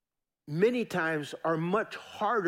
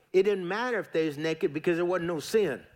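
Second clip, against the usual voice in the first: normal talking voice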